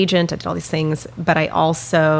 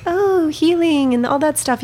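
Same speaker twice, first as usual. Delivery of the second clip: mocking; 11 semitones higher than usual. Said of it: "you're like".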